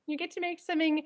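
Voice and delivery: ordinary delivery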